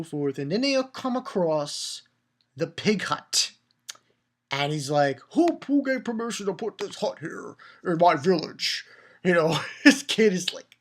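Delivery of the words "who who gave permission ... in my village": higher put-on voice of outrage; mock indignant. Kid finding a pig hut in his village, mock indignation. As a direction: demand answers in a hammy character voice.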